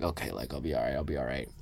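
low, soft murmur to calm himself; hushed self-reassurance. Retold or imitated. Lying in bed hearing gunshots, quietly reassuring himself. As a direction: whisper it to yourself, trying to stay calm. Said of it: "I'm just like".

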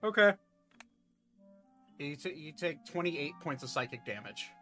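ordinary talk

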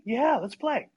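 lightly voiced little boy saying yes; game-for-anything younger brother; eager little brother. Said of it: "And Dan's like".

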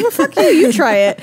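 high, sharp comeback, half joking; mock defiant retort. Actor firing back at critics, mock defiant. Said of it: "It's like".

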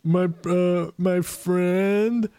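whiny ogre. Acted. Ogre complaining to the party about bullies, whiny. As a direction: whine it like a hurt giant.

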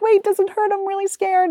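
put-on high, frightened little-girl voice; imitating scared daughter. Mother quotes daughter afraid of jellyfish, high scared voice. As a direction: sound like a worried nine-year-old. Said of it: "Penelope's now starting to be like".

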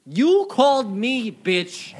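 high, loud, slow mock-offended squawk; mock outrage. Player snapping at a friend in a hammed-up squawk. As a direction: stretch it out in fake indignation.

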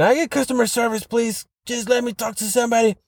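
desperate caller wanting a human; exasperated pleading. Retold or imitated. Pleading with phone robot.

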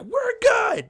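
very high-pitched strained yelp; mock panicked call. Server still hugging the brawler, panicked high yelp. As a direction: re-enact a frantic all-clear.

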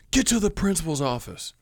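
stern teacher. Retold or imitated. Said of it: "she's like".

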